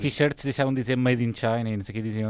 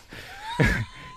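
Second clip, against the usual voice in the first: high squealing laugh. Real, not acted. Host botches the segue on air, squealing laugh.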